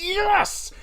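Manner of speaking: a sudden high squeal of joy; excited yes. Retold or imitated. Kid squealing as grandparents agree to buy the game. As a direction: squeal the word out in delight. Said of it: "I'm like".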